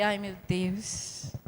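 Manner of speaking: slow, soft sigh of dread; quiet dread. Retold or imitated. Woman dreading the doctor's call, quiet dread. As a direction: let it sink out softly, drawn out.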